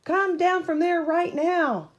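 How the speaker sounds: high, loud holler. A narrator's reading. Train master hollers at George on the sign, loud. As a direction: bark it up at the monkey. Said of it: "he hollered at George".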